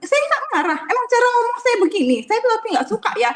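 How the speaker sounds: suara jauh lebih tinggi, menirukan bapak yang marah; melengking menyangkal marah. Retold or imitated. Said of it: "Karena bapaknya marah-marah". (pasien menyangkal marah dengan suara melengking)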